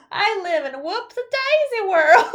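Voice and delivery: giggly country storybook farmer; old farmer. Pretend. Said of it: "when I talk like a farmer".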